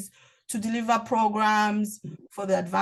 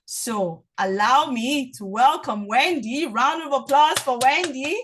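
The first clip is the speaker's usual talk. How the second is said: slow, drawn-out announcer's welcome building higher and louder; rousing introduction; 4 semitones higher, 4 dB louder than usual. Real, not acted. Host welcoming the guest speaker, rousing introduction. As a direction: stretch it out to rouse the applause.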